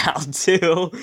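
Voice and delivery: usual speaking voice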